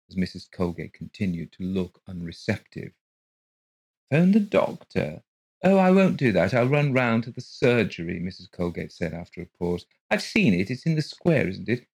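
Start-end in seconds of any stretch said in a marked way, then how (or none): 4.1-8.2 odd woman voice. Acted. Mrs Colgate's odd voice. 10.1-11.9 odd woman voice. Acted. Mrs Colgate's voice.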